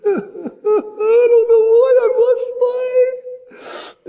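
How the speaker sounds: loud, very high, dragged-out wail; hammed-up sobbing, words stretched into a squeal; mock bawling. Overwhelmed fan answering the woman at Lambeau Field, mock bawling. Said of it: "I can't handle it".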